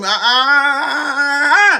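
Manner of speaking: long, loud, high "uh" building up; worked-up wordless holler; drawn-out preacher's cry. Real, not acted. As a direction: stretch it out and let it climb.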